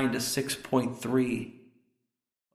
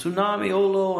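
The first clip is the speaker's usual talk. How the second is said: higher-pitched mimicked warning cry; imitated yelling; 6 semitones higher than usual. Preacher copying what the man seemed to say, mock yelling. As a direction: mimic a foreign-sounding warning call, moderately raised. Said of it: "it sounded like he says".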